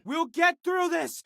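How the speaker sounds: high-pitched call of encouragement; rallying call. Acted. Battle-style rallying cry.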